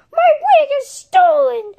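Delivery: high, loud alarmed wail; panicked cry. Pretend. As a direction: shout out the theft in panic. Wig stolen, panicked cry.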